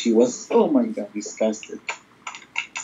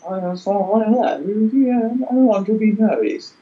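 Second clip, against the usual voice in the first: high, slow posh English accent; posh English accent shown off to a friend; 8 semitones higher than usual. Shown as a demonstration. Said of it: "This is my posh accent".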